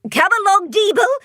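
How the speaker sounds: mother monster calling her son away, wary. Acted. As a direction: hurry the child inside. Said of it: "she says to her son".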